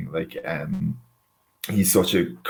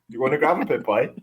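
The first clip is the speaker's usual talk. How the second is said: lightly played-up Cork accent, higher pitch; 4 semitones higher than usual. Cork local inviting a mate to the gravel pit, Cork accent. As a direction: put on a bit of Cork local talk. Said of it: "gravel pit done in a Cork accent".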